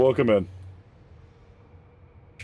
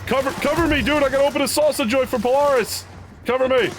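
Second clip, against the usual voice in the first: high urgent yell for cover; cover me shout; 4 dB louder than usual. Real, not acted. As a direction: shout for backup mid-fight. Game firefight, calling to teammate.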